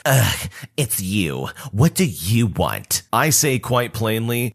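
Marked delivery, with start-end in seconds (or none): 0.0-3.0 slow, put-on scornful mom voice; annoyed Karen. Karen brushing off the boy she stole from, slow put-on scorn. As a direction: let her sneer at the kid, slowly. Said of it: "She says".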